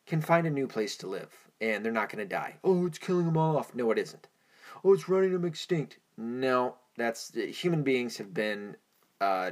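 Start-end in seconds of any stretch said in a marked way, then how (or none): 2.6-3.7 mocking, higher and louder. Mocks climate alarmists' claim. 4.8-6.0 mocking, much higher and louder; sneering parody of the alarmist claim. Mocks climate alarmists' claim.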